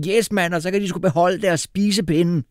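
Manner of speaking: high excited yell; shouting in joy. A narrator's reading. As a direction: cheer it out, voice up high. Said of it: "råbte jeg".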